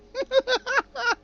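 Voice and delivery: high, loud staccato cackle; hammed-up rich villain laugh; mock gloating laugh. Mocked boss gloats about the money.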